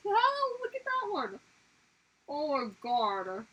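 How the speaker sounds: high, loud startled oh; shocked gasp. Real, not acted. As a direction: recoil at a gruesome photo. Shocked by a scarred dolphin photo.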